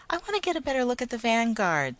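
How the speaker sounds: put-on high, loud child shout; boy shouting to see the rocket; excited child shouting. A narrator's reading. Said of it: "the boy shouted".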